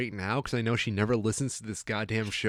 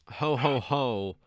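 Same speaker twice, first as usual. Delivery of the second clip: jolly ho ho ho; slower than usual. Acted. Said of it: "I love that alliteration".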